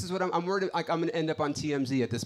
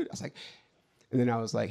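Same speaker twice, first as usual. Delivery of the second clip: ordinary talk, nothing marked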